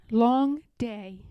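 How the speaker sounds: ordinary delivery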